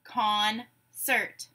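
"Concert" split in two, pause between syllables. Shown as a demonstration. Teacher gives syllables to blend, "Concert" broken apart. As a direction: say "Concert" slowly as con, pause, cert. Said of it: "I will say syllables in a word".

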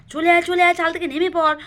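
urgent calling. Acted. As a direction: call the child down quickly. Urging child down from roof.

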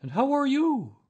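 very high, loud bear greeting; storybook bear. A narrator's reading. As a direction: call it out high in a character voice.

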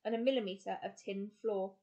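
ordinary delivery

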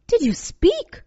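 high-pitched question full of wonder. A narrator's reading. Girl to a scarecrow that just spoke, in wonder. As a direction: ask it high, in amazement. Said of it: "asked the girl in wonder".

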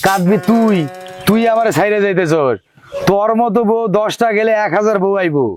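ordinary delivery

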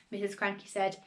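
ordinary delivery

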